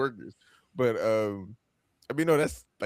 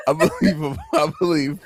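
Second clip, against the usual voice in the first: loud laugh breaking the words; can't get it out for laughing; laughing at himself; laughing through words; 6 semitones higher, 6 dB louder than usual. Real, not acted. Laughing at own claim.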